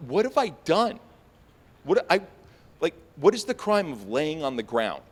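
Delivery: high-pitched, baffled protest; acted bewildered panic. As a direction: plead as if wrongly accused. Acts out bewildered panic under arrest.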